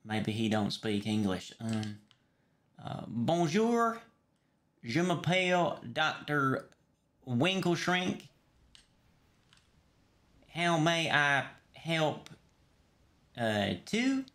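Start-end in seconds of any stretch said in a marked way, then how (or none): 2.8-8.2 slow, halting French introduction. Pretend. Doctor greeting a foreign patient, halting French. Tries French on a non-English speaker. 10.5-14.2 very slow, higher-pitched, drawn-out question; slowed for foreigner. Pretend. Doctor to patient who may not speak English, slowed right down.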